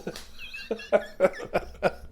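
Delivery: high breathy laugh at his own story; laughing hard at the punchline; burst of laughter. Real, not acted.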